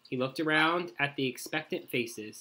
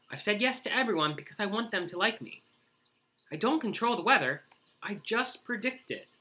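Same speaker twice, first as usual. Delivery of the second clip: anxious rush; 6 semitones higher, faster than usual. Acted. Groundhog's anxious rush of worry.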